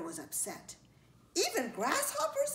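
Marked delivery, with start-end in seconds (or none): 1.3-2.5 very high, incredulous grasshopper; alarmed question. A narrator's reading. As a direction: squeak out a worried question.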